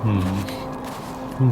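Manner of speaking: ordinary talk, nothing marked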